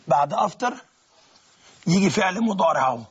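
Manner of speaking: ordinary delivery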